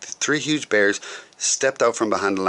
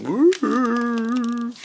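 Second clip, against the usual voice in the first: high, long wavering ghost woo; ghostly moan. Acted. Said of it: "The ghost moaned at him".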